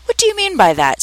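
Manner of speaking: high-pitched stern demand. A narrator's reading. The caterpillar challenges Alice's answer, sternly.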